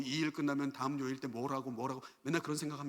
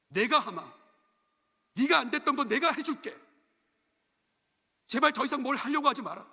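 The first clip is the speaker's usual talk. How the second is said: loud, high, insistent plea; God pleading; 9 semitones higher, 7 dB louder than usual. Acted. God telling a striving believer to let Him do it, pleading loudly. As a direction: take over forcefully as God's voice. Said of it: "하나님 말씀하십니다".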